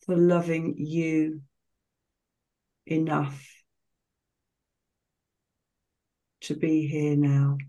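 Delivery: slow, calm meditation voice with long pauses between phrases; guided meditation. Real, not acted. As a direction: guide listeners gently into relaxation, phrase by unhurried phrase.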